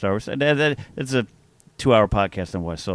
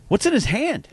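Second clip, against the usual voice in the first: cashier voice; 11 semitones higher than usual. Retold or imitated. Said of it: "He goes".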